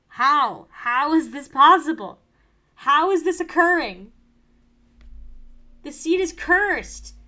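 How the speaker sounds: high, loud, incredulous cries; loud exasperated rant about a cursed seed; loud disbelief. Real, not acted. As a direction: shout at the cursed luck in disbelief.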